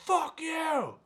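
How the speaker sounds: high mock snap; girl's retort. The offended girl telling the speaker off, high mock snap. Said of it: "she goes".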